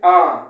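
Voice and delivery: आ said loud as a bare vowel; mocking the mistake, आ; a loud bare आ for who; wrong pronunciation aa. Shown as a demonstration. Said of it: "बहुत लोग इसको आ कहते हैं".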